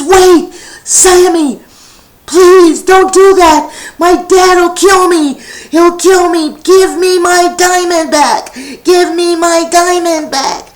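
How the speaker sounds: high, loud panicked begging; pleading child. Acted. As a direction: beg him to give it back. Frankie begs for his diamond.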